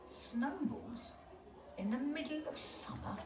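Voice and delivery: owl's incredulous realization. Acted. Owl realizes.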